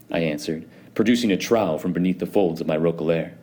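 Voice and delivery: ordinary delivery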